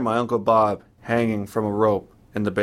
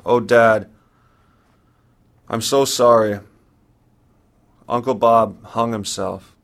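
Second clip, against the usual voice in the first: tearful; 4 dB louder than usual. Retold or imitated. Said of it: "I can't fight the tears any longer".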